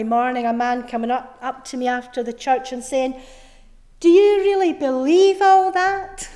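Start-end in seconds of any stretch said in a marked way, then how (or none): 4.0-6.3 high, loud disbelieving question; acted-out sceptic's loud, high question; incredulous challenge. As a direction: challenge them in open disbelief, pitched up and loud. Acted challenge from sceptical man after church.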